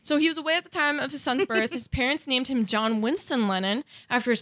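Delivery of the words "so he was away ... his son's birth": put-on higher, quicker Harry Potter British accent. Host retries the sentence for her friend, quick British accent. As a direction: rush the line in a Hermione British accent. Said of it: "the only way I can do one is like a Hermione".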